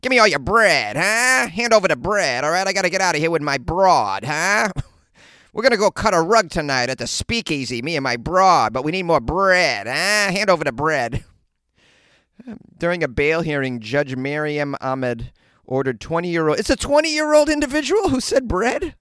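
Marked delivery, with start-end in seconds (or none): 0.0-11.2 put-on higher, louder old-movie gangster patter; mock vintage hoodlum voice; old-timey gangster. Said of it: "Did he also have a voice like this?". 16.6-18.9 high-pitched, louder disbelief; comic outraged astonishment; incredulous. Real, not acted. Reacts in disbelief to the story.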